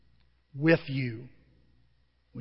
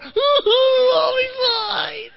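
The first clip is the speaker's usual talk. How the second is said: high, slow, wailing mock-crying shout; mock sob; 7 dB louder, much slower than usual. To his worried friends, a hammed-up sobbing yell. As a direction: ham up a tearful brave-face wail. Retelling his brave reply.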